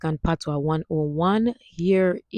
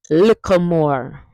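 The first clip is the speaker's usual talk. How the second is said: "Little more" slow, as "likka more"; 5 dB louder, slower than usual. Shown as a demonstration. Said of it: "Hear that?".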